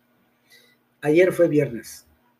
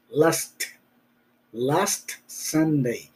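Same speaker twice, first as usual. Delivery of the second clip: very slow, soft LAST SUNDAY with the T sounded; slow careful demo; 4 dB quieter, much slower than usual. Shown as a demonstration. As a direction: drag out last Sunday so the T is heard. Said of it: "hay que tener cuidado que suene esa T".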